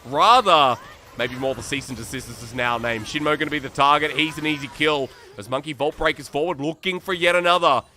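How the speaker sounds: fast, rising esports casting call; live play-by-play. Real, not acted. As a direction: ride the action like a live caster. Solo caster calling the game live.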